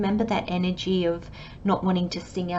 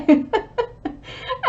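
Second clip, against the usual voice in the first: unvoiced giggle rising high; breathy high laugh. Real, not acted. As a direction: let a breathy giggle escape. Laughing at her own surprise.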